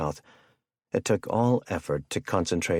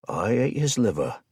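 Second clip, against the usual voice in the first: hammed-up British accent, pitched higher; poor British accent; 4 semitones higher than usual. A narrator's reading. Captor quotes a movie to his captive, poor British accent. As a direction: put on a bad British accent. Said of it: "he said in a poor British accent".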